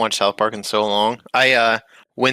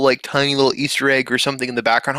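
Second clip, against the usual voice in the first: usual speaking voice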